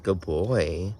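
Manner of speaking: slow low praise; praising a pet. Real, not acted.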